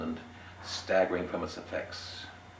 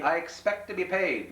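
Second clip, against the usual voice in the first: higher, pointed innkeeper voice; 11 semitones higher than usual. Acted. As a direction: lean on paid, pitched up. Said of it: "said pointedly".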